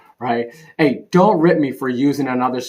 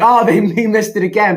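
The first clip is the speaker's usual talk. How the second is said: excited relief; 10 semitones higher, 7 dB louder than usual. Retold or imitated. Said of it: "you're like".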